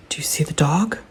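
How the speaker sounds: teasing whisper to the dog; hushed coaxing question. Real, not acted. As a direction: breathe it to the dog like a secret.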